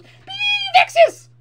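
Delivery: high, loud mock shriek of Vexes; mob boss shriek. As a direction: screech it like a summoning mob. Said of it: "raises its hands and goes".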